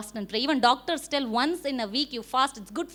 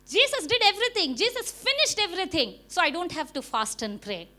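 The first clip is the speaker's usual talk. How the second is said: higher, quick, brushing it all off; mocking dismissive believer; 5 semitones higher than usual. Preacher mocking believers who skip fasting, higher, quick and dismissive. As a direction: imitate the believers who skip prayer, shrugging. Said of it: "They say".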